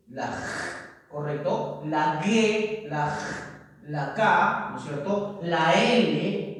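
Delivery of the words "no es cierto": ordinary talk, nothing marked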